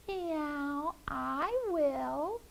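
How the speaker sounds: high, soft mewing cat. Acted. Cat friend softly offering to help. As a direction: purr it out softly like a little cat. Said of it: "her friend the cat said".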